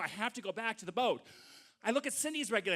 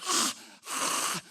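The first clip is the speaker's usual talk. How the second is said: imitated regulator breaths; 3 semitones higher than usual. Tank breathing sound.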